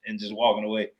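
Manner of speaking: ordinary talk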